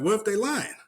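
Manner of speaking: high, disbelieving question; mock incredulous host. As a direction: ask it in pure disbelief. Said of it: "He's like".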